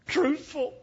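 put-on high falsetto old wife, pleading; Fanny Victoria's voice. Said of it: "She said".

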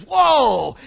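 slow, loud "whoa" of wonder; awed shout. Real, not acted. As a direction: let the wonder burst out. Preacher overwhelmed by the idea.

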